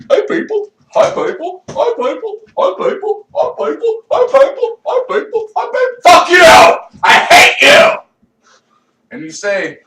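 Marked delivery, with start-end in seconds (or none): 0.1-6.0 very high, sing-song hi people; high cutesy greeting voice; mock friendly greeting. 6.0-8.0 sudden loud, high yell; hammed-up furious shout; mock outburst. Turning on people in the street.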